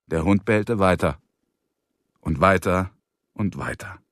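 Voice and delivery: ordinary delivery, nothing marked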